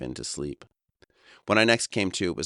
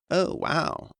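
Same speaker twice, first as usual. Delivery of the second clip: much higher, social surprise; polite oh wow; 13 semitones higher than usual. Retold or imitated. Hearing a friend's car was stolen, a polite high oh wow. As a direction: feign polite shock. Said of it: "my propensity for social niceties".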